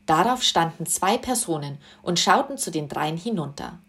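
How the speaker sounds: plain reading voice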